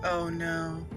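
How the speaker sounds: usual speaking voice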